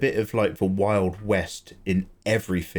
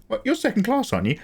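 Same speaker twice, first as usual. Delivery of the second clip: much higher, sing-song mocking accuser; mock needling accuser; 11 semitones higher, faster than usual. Foreigner taunting an English person about money, in a much higher mock voice. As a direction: pitch it way up like someone needling.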